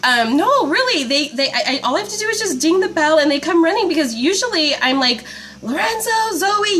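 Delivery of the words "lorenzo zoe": high mock call for the kids; imitating calling the kids, lightly; a hint of a mom yelling; mock yelling names. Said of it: "yelling and yelling and yelling for them".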